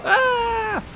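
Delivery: sudden high yelp; startled yelp. Real, not acted. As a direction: yelp out in surprise. Sudden cut-off in game.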